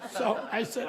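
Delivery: ordinary talk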